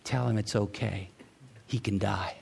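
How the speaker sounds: soft, low, hushed inner voice; hushed voice of God; quoted inner voice of the Lord. Retold or imitated. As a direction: quietly relay the Lord's words. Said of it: "I felt like the Lord said to me".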